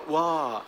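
weak mumbled wah; limp wah. Shown as a demonstration. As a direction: say it with no energy. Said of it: "You can't just go".

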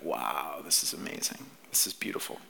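acted low, half-whispered wonder; hushed awe. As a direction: breathe it out softly, marvelling. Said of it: "they're just in awe".

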